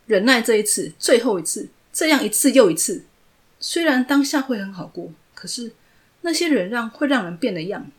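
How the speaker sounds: loud, high furious roar; roaring in anger. Acted. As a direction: roar it out at him, fed up with giving in. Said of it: "怒吼着".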